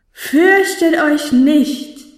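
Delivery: very slow, high proclamation; piercing, clear heavenly voice. A narrator's reading. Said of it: "Durchdringend, klar und dennoch beruhigend zart". (durchdringende, klare Himmelsstimme, langgezogen)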